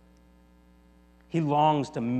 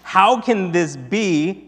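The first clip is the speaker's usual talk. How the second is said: higher, louder, slow cry of amazement; amazed exclamation; 5 semitones higher, 6 dB louder, much slower than usual. A narrator's reading. Reader voicing the crowd at Pentecost, amazed. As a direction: exclaim it slowly, word by word. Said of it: "they exclaimed".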